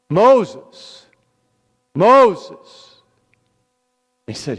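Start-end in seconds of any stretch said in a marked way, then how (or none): 0.1-3.0 loud, high call ringing out. A narrator's reading. God calling Moses from the burning bush, loud high call. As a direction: call his name out loud across a distance. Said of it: "he called out to Moses".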